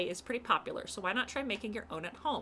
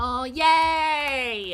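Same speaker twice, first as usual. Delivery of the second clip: loud happy cheer; excited cheer; 5 semitones higher, 13 dB louder than usual. Real, not acted. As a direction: burst out with joy. Cheering at game event.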